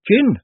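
one firm, high, loud word; firmly. Acted. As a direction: cut in and state it flatly. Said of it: "said Peggy firmly".

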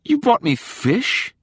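high, drawn-out disbelieving question; baffled, high-pitched disbelief; incredulous. A narrator's reading. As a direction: question the gift in dismay. Disappointed by an odd gift.

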